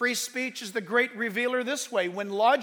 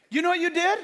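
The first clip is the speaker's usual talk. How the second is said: high, loud stranger voice; big guy's question; 9 semitones higher, 7 dB louder, faster than usual. Retold or imitated. Stranger confronting pastor in parking lot, loud. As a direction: accuse him in a big voice. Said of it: "the guy goes".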